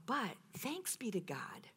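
soft slow whisper of gratitude; whispered thanks. Real, not acted. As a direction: breathe it out as a private prayer. Whispered praise.

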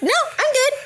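very high, chirpy refusal; fake casual. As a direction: wave it off in a squeaky-high voice. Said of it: "I don't like people to know when I'm, like, in pain".

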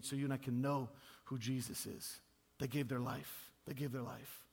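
low, soft, earnest pulpit voice; solemn preaching. Real, not acted. Preacher honours those who gave their lives, solemn and hushed. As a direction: drop the voice for a grave tribute.

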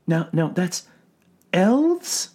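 soothing clinical questioning; calm doctor voice. A narrator's reading. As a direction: gently probe a patient. Doctor voice in read story.

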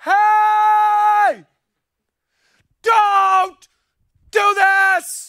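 full-force, high, long-held warning shout; Holy Spirit shouting. Acted. Holy Spirit warning someone at the last moment, full shout. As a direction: shout each word separately, stretched and loud. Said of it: "just starts shouting".

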